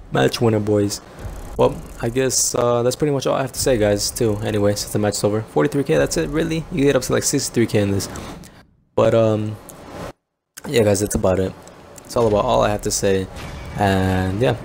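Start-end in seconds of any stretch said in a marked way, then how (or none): none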